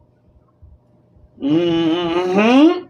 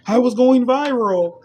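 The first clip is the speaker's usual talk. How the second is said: higher mock voice; imitating dismissive future people; 8 semitones higher than usual. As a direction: sneer as the future folks. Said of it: "They gonna be like".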